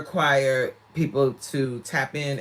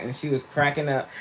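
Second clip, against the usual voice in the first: ordinary talk, nothing marked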